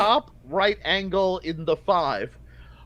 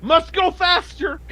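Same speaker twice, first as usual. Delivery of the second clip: frantic urging; 9 semitones higher, 5 dB louder than usual. Acted. GM voices panicked NPC.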